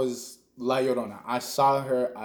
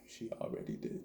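much softer, whispered repeat; hushed eerie whisper; spooky whisper; 17 dB quieter than usual. Acted. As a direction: breathe it like a ghost story. Ominous callback after friend's warning.

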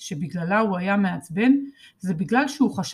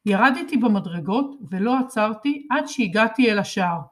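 ordinary delivery, nothing marked

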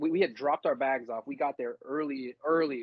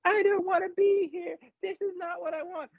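much higher, wailing; scream crying. Retold or imitated. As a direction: wail it in a mock sob. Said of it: "scream crying at her".

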